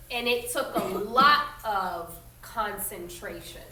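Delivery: reading a picture book aloud to the class; steady storytelling voice for children; story narration. A narrator's reading. Teacher reading a history story aloud to her students, in a clear storytelling voice.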